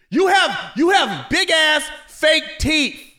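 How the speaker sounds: slow high loud taunt at the rapper's teeth; slow mocking taunt. Real, not acted.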